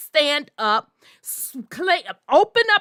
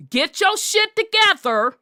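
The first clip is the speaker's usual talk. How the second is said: raised demand to get it together; 6 semitones higher than usual. Acted. Challenging intimidated men.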